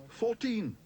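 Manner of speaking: shouted. A narrator's reading. Paddington shouting his bid at the auctioneer. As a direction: shout it out eagerly. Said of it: "shouted Paddington".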